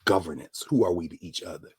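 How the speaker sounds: ordinary talk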